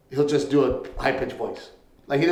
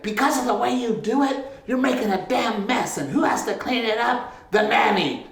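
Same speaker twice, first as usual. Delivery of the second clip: nagging wife; 7 semitones higher than usual. Retold or imitated. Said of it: "this one here was like".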